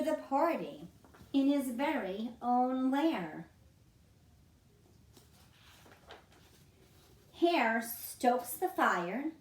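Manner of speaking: gentle storybook read-aloud; reading to children. A narrator's reading. Reading picture book aloud.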